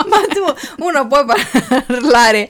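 laughing. Real, not acted. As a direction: laugh while protesting. Ride durante la registrazione.

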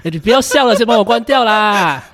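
embarrassed, high and loud, begging her to stop laughing; panicked whine to shut the camera off; flustered high plea. Acted. Teacher caught on Zoom begs his partner to stop laughing, flustered high plea.

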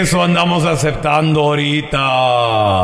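low, slow rasping demon; raspy devil. Acted. Devil telling the man his terms, low raspy drawl. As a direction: drag it out in a demonic rasp. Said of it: "Qué voz tan rasposa".

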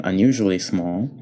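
ordinary delivery

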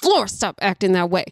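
warning squeezed through clenched teeth; tight-jawed mom warning; talking through teeth. Retold or imitated. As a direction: hold back the yell, talk through your teeth. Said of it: "I'm talking through my teeth".

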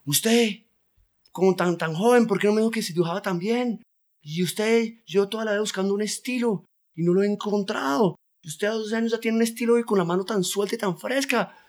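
put-on high, astonished outburst of an old master; amazed master painter. Old painter marvels at his twelve-year-old pupil, high and astonished. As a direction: gush over the boy's talent in disbelief. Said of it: "y le dice".

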